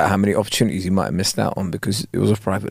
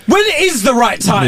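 high, slow melodramatic demand; soap opera outburst; 11 semitones higher, slower than usual. Acted. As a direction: stretch it out, high and overwrought. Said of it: "soap opera way".

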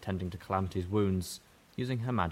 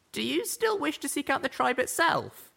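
high pony question; 3 dB louder than usual. Acted. Asks young zebra.

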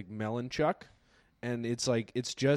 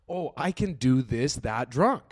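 slow smug inner voice; 3 dB louder, slower than usual. Retold or imitated. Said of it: "I was like".

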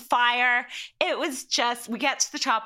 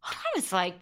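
ordinary delivery

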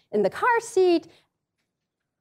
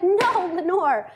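higher, drawn-out exasperated mimicry; slow, lightly indignant protest; mock angry neighbor; 6 semitones higher, much slower than usual. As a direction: stretch it out as an exasperated friend. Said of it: "she was so angry".

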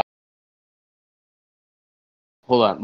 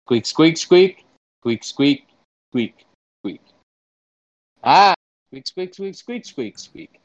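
squirrel talk; 4 semitones higher, slower than usual. Pretend. Interpreter relays the question to a squirrel, squirrel talk. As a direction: pretend squirrel squeaking in short bursts.

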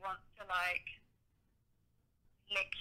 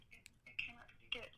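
whispered afterthought; 3 semitones higher, 12 dB quieter than usual. Real, not acted. Unvoiced trailing remark.

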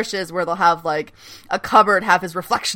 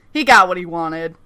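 ordinary delivery

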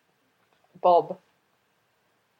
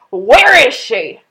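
angry father; 3 semitones higher, 9 dB louder, much slower than usual. Acted.

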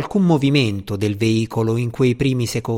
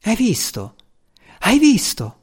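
higher-pitched, eager exclamation, building on the repeat; excited crowd voice; 8 semitones higher than usual. A narrator's reading. Onlookers at a shuttle launch call to one another, excited. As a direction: voice an amazed spectator, pitched up. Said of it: "parlavano a gran voce".

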